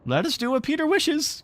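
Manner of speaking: put-on high, loud eager boy's voice; simple boys crying. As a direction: rush to obey Peter. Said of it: "cried the simple boys".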